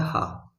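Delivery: "हा" as a soft breathy h-aa; "हा" voiceless, just air; h sound demo. Shown as a demonstration. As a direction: breathe out "हा" on its own. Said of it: "एच का sound क्या होता है".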